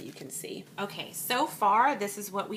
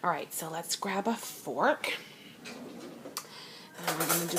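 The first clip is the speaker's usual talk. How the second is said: whispering; 6 dB quieter than usual. Real, not acted. Admiring the dish up close.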